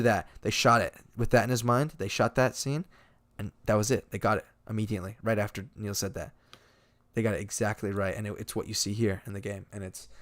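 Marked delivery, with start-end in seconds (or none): none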